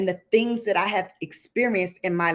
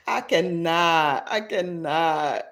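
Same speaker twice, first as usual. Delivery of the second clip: laughing. Real, not acted. Laughing at chat.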